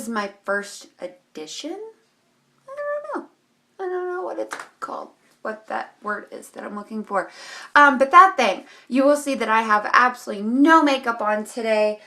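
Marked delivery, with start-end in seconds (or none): none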